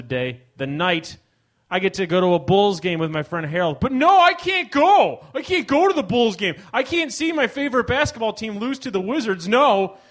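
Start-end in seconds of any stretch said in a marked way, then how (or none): 3.8-10.0 high, loud hammed-up complaint; hammed-up indignant rant; mock outrage. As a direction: whine like it's a tragedy. Mock outrage at missing game.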